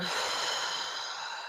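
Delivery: soft, breath-only sigh blown out; long releasing exhale. Shown as a demonstration. Leader models a releasing breath for the group, long exhale. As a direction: blow the breath out softly and fully. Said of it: "Exhale, blow it out".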